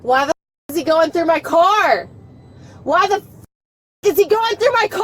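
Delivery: real outraged yell, rising in pitch; screaming. Real, not acted. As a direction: scream the demand, building to the last word. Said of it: "Why are you screaming?".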